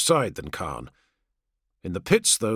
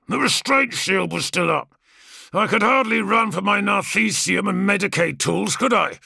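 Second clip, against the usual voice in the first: a touch higher, cocky and defiant brother; swaggering gladiator, barely hiding scorn; lightly voiced brash warrior; 7 semitones higher, 3 dB louder than usual. Kargos defends himself.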